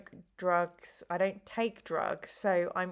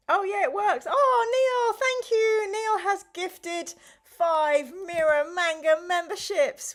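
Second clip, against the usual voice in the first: high, loud, delighted outburst; real excitement; 10 semitones higher, 8 dB louder, slower than usual. Real, not acted. Streamer thanking a viewer for gifted memberships, high excited shout. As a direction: cry out your thanks at the top of your range.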